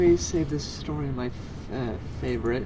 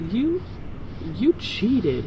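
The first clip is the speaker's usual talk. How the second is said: flustered stammer, pitched up; 7 semitones higher, much slower than usual. A narrator's reading. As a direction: stumble over the words. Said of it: "he stammered".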